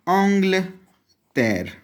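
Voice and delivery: एंग्लतैर drawn out very slowly and high; एंग्लतैर spoken slowly in French; slow French word. Shown as a demonstration. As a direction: stretch every sound of एंग्लतैर. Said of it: "England के लिए क्या था".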